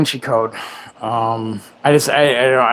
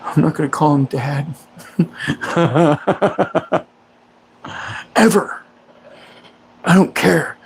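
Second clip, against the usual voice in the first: sulky defiant line, lightly voiced; hint of a petulant stepson; Step Brothers quote. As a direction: pout like a stubborn grown kid. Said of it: "I love that movie, Step Brothers".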